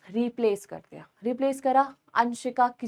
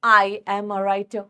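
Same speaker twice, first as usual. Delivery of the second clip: slow example sentence; 4 semitones lower, much slower than usual. Shown as a demonstration. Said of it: "मैंने बोला".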